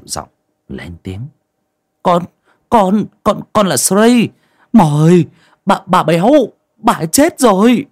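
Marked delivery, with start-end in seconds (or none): 2.0-7.9 high-pitched nervous stammering; halting, higher-voiced deference; meek, restrained voice. A narrator's reading. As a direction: stammer out bad news timidly. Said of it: "nén cơn bực bội, cố dịu giọng".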